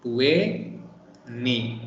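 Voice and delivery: "Twenty" said tweny, T after N dropped; "Twenty" without the T sound, tweny; American twenty. Shown as a demonstration. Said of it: "in American accent".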